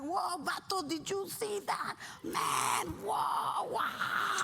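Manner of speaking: high, loud mock astonishment; amazed homies. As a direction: gape out loud like an awestruck homie, building to a wow. Acted homies amazed at salute.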